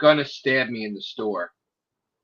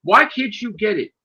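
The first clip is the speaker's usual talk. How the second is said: higher-pitched, insistent scolding; retold exasperated berating; 7 semitones higher than usual. Officer telling him he will get killed, exasperated berating. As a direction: plead angrily as if imitating the officer.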